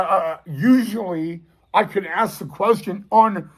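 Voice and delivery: ordinary talk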